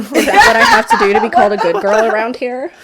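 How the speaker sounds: loud, laughing sarcasm; mock-innocent jab; sarcastic, laughing. Real, not acted.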